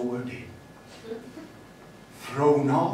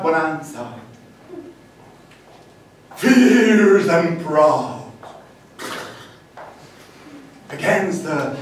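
greasy Texan poem; 6 semitones higher, 11 dB louder than usual. Acted. Poet reciting his horse-ride poem to the audience, loud then fading. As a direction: charge it proudly, then let it sink. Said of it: "to be read in a greasy Texan accent".

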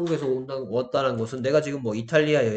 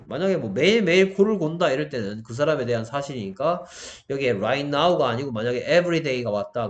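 ordinary talk, nothing marked

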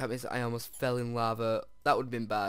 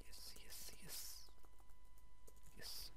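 breathy much softer yeses; whispered yes; 16 dB quieter, slower than usual. Real, not acted. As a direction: whisper the triumph under your breath. Gold smelting.

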